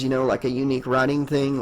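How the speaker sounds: ordinary delivery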